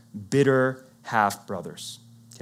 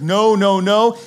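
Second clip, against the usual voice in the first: loud, higher repeated no; adamant refusal; 7 semitones higher, 9 dB louder, slower than usual. Retold or imitated. The father refuses Judah's plan, loud and high. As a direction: shut the idea down hard.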